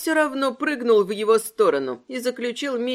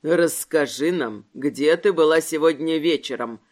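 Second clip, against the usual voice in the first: growled question; 7 semitones lower than usual. A narrator's reading. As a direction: growl it low and threatening. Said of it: "прорычал Микелетто".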